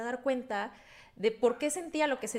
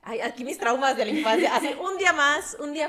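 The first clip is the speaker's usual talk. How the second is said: laughing; 4 semitones higher, 8 dB louder than usual. Real, not acted. Laughing at her childhood traumas.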